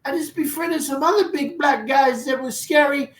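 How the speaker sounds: very high, squeaky mocking voice. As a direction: imitate a naive, timid dude in falsetto.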